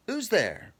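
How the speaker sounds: high, short questioning call. A narrator's reading. Doctor answering Bessie's knock, calling out.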